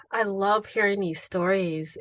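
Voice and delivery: ordinary delivery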